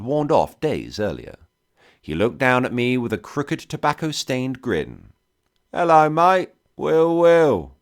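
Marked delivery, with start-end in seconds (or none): 5.7-7.8 loud, high thick London cockney. A narrator's reading. Whiskey trader greets stranded soldier, loud thick cockney. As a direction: belt it out as a cheery cockney trader. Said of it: "in that thick London cockney".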